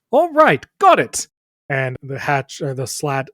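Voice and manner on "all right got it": very high, loud surrender; doorkeeper giving in. Acted. Doorkeeper yielding to a threat, high and loud. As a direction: yelp it out quickly in a raised pitch. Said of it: "He says".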